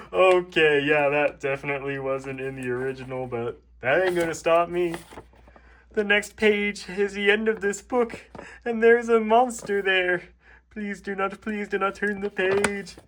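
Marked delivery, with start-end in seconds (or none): 5.9-13.0 higher pleading character voice; pleading Toad. Acted. Toad begging the reader before the last page. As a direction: beg the reader not to turn. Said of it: "Toad voice".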